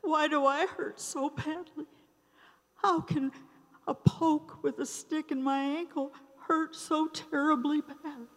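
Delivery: high, hushed, bewildered self-questioning; pained inner plea. Retold or imitated. Alone in her apartment, wondering at the pain: high, hushed plea. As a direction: ask yourself quietly, high and lost.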